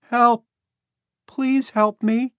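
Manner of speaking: put-on high, loud child's cry for help; lost boy calling for help. A narrator's reading. Lost boy calls out to strangers, high and loud.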